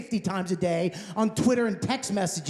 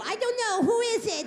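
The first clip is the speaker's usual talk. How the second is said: mock wife voice; 11 semitones higher than usual. Imitating wife playing guessing game.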